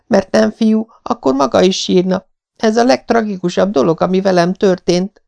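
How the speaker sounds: put-on a hurt child making her case; tearful young girl. A narrator's reading. Anne tells Marilla why she must cry, plaintively.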